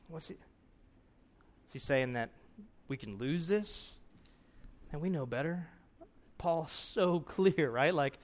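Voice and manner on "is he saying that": ordinary delivery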